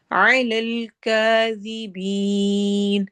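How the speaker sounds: slow chanted tajweed with a long drawn ha; Quranic recitation. Shown as a demonstration. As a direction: recite it, stretching the vowel. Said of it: "مد عارض للسکون".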